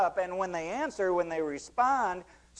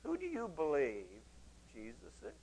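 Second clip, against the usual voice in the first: softer, hushed, asked one to one; soft, personal question; 3 semitones lower, 11 dB quieter than usual. Shown as a demonstration. Preacher shows how to ask a stranger about Jesus, softly. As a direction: ask it quietly and personally, like a friend. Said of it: "go up to someone outside of Christ and say".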